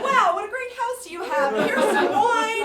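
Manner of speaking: high, loud and flustered, laughing; flustered chirp. Retold or imitated. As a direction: babble nervously to cover shock. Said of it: "backpedaling and saying".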